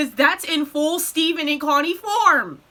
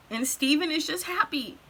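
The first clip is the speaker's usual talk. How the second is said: ordinary delivery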